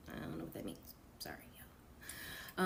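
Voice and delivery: soft breathy apology to listeners; whispered aside. Real, not acted. Narrator whispering a quick sorry to her listeners. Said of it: "aside to listeners, whispered".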